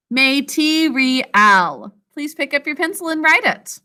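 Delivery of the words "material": "Material" slowly as may-tee-ree-al; "Material" syllable by syllable, full vowels; sounded out for spelling. Shown as a demonstration. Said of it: "Sound it out with me".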